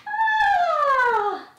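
put-on very high, sing-song baby talk; squeaky pet voice. Trainer greeting her little dog in a very high squeak.

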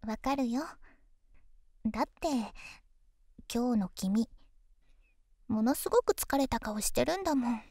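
pillow talk. Acted. Girlfriend soothing tired boyfriend.

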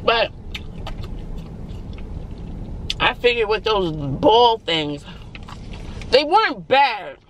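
chewing between words; snacking while talking; talking while eating. Real, not acted. Seen 2: Holds fries throughout, mouth seems to chew between words.

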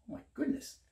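ordinary delivery, nothing marked

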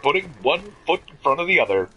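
lightly done news anchor read; newscaster voice. Acted. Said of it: "talk like a newscaster".

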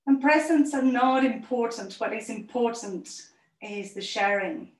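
gentle meditation voice, fading to a whisper; calm closing relaxation. Acted. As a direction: settle the children into stillness.

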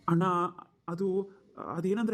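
halting, long pauses between words; hesitant stammering. Acted. Younger brother unable to tell his brother the matter, hesitant. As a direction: keep starting and stalling. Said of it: "ಅದು ಅದು ಅದು ಅಂತ ತೊದಲಿಸ್ತೀಯಾ".

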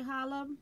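"Holla" with a sounded H; mispronounced hola. Real, not acted. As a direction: say "Holla" with an audible H at the start. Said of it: "I pronounced the H".

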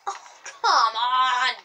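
loud, long-drawn groaning yell; exasperated. Real, not acted. Gamer complains at getting shot, exasperated yell. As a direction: drag the words out loudly in frustration. Frustrated at game.

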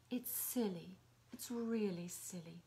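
soft, hushed reassurance; soothing whisper. Acted. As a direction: gently hush his worries away. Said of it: "You like me to whisper to you".

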